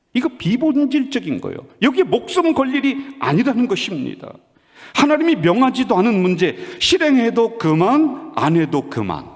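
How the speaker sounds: high, emphatic sermon delivery, dropping on the last phrase; urgent preacher pitched high; impassioned preaching. Real, not acted. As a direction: drive the point home from the pulpit. Preaches at full force.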